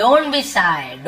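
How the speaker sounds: Don't be shy drawn out word by word; slow model phrase. Shown as a demonstration. Teacher slowly shows students how to read Don't be shy. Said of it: "ऐसे shy ऐसे पढ़ते हैं".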